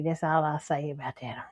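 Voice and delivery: unvoiced hushed aside; dropping to a whisper to end the topic. Real, not acted. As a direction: whisper it like a secret you shouldn't share.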